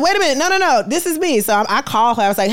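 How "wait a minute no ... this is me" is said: higher, excited cry of recognition; high, thrilled wait-a-minute; excited disbelief. Retold or imitated. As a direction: burst out as it dawns on you. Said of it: "I said".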